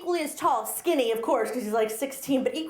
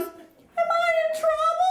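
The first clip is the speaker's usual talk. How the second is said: much higher, slow, mock-innocent; innocent little voice; slower than usual. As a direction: squeak it like a guilty kid. Daughter asks, voiced sweet.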